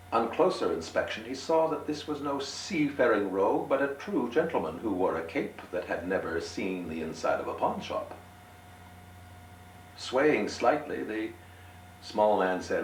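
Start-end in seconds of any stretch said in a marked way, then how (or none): none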